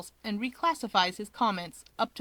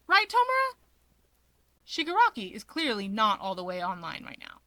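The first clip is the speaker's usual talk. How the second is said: ordinary delivery, nothing marked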